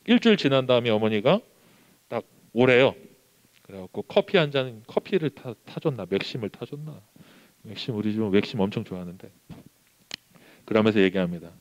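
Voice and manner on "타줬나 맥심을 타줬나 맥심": normal talking voice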